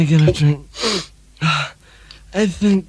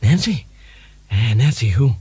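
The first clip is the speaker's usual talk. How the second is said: Ronald Reagan impression; 5 semitones lower than usual. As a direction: play a drowsy, forgetful Reagan. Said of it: "Ronald Reagan".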